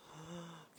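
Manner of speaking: quick, soft intake of breath; excited gasp. Real, not acted. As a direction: gasp in delight at a fan's message. Gasps before thanking Chicago.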